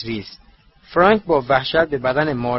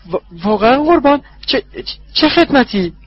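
much higher, louder, stuttering with fear; very frightened; 11 semitones higher, 6 dB louder than usual. Acted. Wormtail terrified, asking his master what service he wants. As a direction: stutter in terror. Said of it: "ورمتیل خیلی ترسیده بود".

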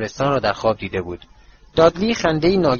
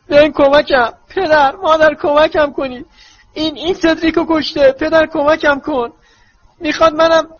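mock crying for help; 7 dB louder than usual. Dudley mimics Harry's nightmare, crying out.

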